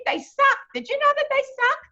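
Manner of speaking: chattering Yankee. Retold or imitated. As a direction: squeal about the stingrays. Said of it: "She said".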